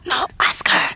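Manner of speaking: playful stage whisper; hiss-free, voiceless whisper passed to your co-host. Pretend. Co-host passing the question back, playfully whispering. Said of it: "why are we whispering?".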